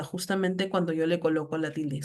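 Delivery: usual speaking voice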